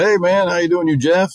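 high, loud, upbeat greeting; bright hey-man hello from the shop guy; cheery mechanic greeting. Retold or imitated. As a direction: play the overly chipper guy at the counter. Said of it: "The guy goes".

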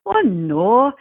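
mock Scottish brogue, stresses askew; Scottish accent. Podcaster quoting comedian Mike Myers on doing accents, Scottish accent. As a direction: put on a comic Scottish accent. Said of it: "is it hard to do a Scottish accent".

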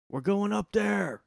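slow high yell of a reckless fan; drunk fan shout. Retold or imitated. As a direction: shout it like a dumb young fan. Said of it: "they're just like".

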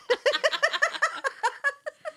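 squealing high-pitched laugh; laughing at her own failed accent. Real, not acted. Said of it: "I can't do it with an accent".

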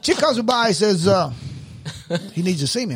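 usual speaking voice

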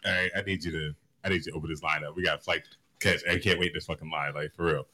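deep, soft, coaxing plea to the agent; low sweet-talking. Retold or imitated. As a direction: sweet-talk the TSA lady low and soft. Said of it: "I was like".

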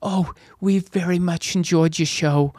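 high, wobbly attempt at older British; bad British accent. Older British audience member to comedian after the show, bad British accent. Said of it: "older British people come up to me like".

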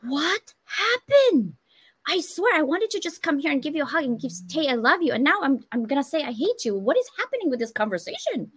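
high, exasperated acted outburst; bewildered argument voice. Partner mid-argument after good intentions, acted bewildered exasperation. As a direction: stretch the opening out high, then plead in disbelief. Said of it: "you're in the middle of an argument".

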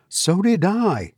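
high, slow woman's reply; unsteady voice. A narrator's reading. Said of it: "in a voice which had lost its steadiness".

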